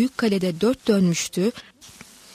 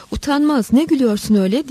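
high, louder outburst of indignation; angry shout; 5 semitones higher, 5 dB louder, faster than usual. A narrator's reading. Furious husband scolding his laughing wife, angry raised voice. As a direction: narrate it as an angry, raised-voice rebuke. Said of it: "diye bağırdı müthiş öfkeli".

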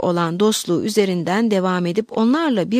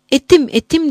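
higher, louder distressed cry; an understated sob, raised in pitch; mock sobbing; 6 semitones higher, 5 dB louder than usual. A narrator's reading. Novice distraught beside the abbess, lightly acted sobbing outcry. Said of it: "diye hıçkırdı rahibe adayı".

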